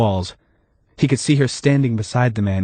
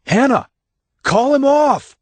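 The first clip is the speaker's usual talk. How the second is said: high, loud cry up from the pit; desperate yell; 4 dB louder, slower than usual. Acted. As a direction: yell up to Hannah for help. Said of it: "light and yelled".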